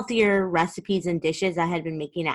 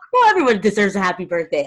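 laughing, louder and fast; 4 semitones higher, 9 dB louder, faster than usual. Real, not acted. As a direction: talk through a laugh. Guest laughs while answering.

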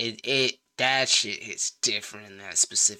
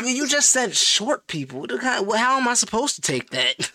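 indignant; 12 semitones higher than usual. Real, not acted. Protesting short joke.

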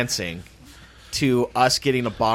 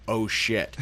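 laughing under breath; half-laughed curse; laughing oh shit; 3 semitones lower, 5 dB quieter than usual. Retold or imitated. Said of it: "it was like".

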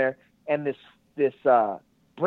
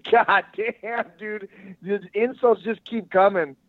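mock outrage; 6 semitones higher, slower than usual. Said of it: "And I go".

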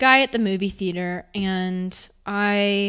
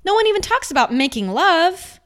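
higher, louder, insistent; emphatic; 6 semitones higher, 5 dB louder than usual. Real, not acted. Urging viewers about intimacy, with passion. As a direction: press the point with passion. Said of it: "impassioned point".

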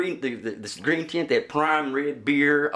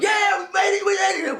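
triumphant cheer. Retold or imitated. Buddies pulling into the backstage lot, yelling in triumph. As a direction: shout it like you just snuck in.

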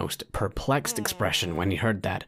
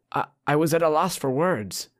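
flustered; 6 semitones higher than usual. Acted. Chad flustered after failing.

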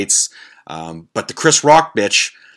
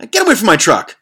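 loud, higher angry yell; retold shout; 9 semitones higher, 5 dB louder than usual. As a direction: bark it at a beggar by your truck. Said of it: "I looked at her, I said".

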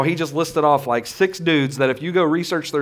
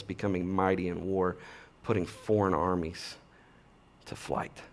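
hushed solemn recital; 7 semitones lower, 8 dB quieter than usual. Acted. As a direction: let each feat sink in, quieter and quieter. Same list repeated after mock eulogy.

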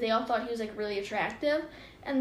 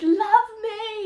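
mock screaming plea; 9 semitones higher, 5 dB louder than usual. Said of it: "I'm like".

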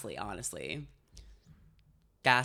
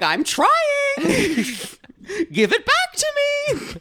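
hammed-up frantic plea, much higher and louder, laughing into a fake sob; mock panic; 7 dB louder than usual. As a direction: squeal it like you're half crying and half laughing. Voicing own panic in story.

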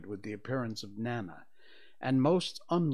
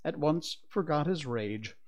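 plain reading voice

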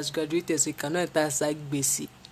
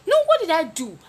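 high, loud what did I do; outraged protest; indignant loud protest; 11 semitones higher, 8 dB louder than usual. Retold or imitated. Protesting the unfair beating.

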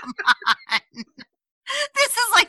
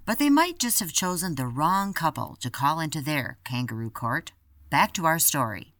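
podcast teaser narration; 9 semitones lower, 7 dB quieter than usual. Acted.

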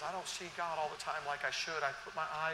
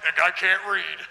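mock scornful put-down; 14 dB louder, slower than usual. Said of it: "they're like".

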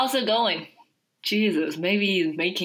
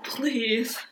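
pleading; 7 semitones higher than usual. Acted. Clint begs again.

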